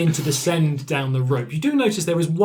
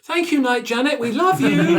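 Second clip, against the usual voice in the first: put-on high, excited kid voices; freed children thank Night Janet, high and eager; grateful children; 13 semitones higher, 4 dB louder than usual. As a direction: gush it like thrilled little kids. Freed children thank their rescuer.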